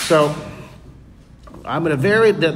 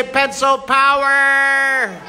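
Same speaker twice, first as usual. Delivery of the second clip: put-on drawn-out higher cheer, leading the kids; 7 semitones higher, 3 dB louder, much slower than usual. Shown as a demonstration. Art camp host leads kids in a slow cheer. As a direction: stretch it out like a rally chant. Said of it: "Someone say".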